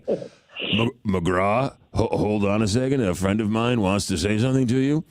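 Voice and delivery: imitated Jack.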